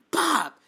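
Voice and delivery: high, loud "pop"; sudden high-pitched popped hit; mock punch sound. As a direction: punch out a pretend smack noise. Mimes punching him in the face.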